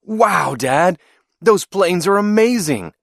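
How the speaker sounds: high, thrilled exclamation; screamed excitement. A narrator's reading. Boy watches racing planes with his dad, screaming excitedly. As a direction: burst out in excited wonder. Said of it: "I screamed with excitement".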